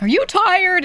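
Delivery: high, loud nosy question; exaggerated worried check-in; mock concern. Acted concerned people seeing eye bags.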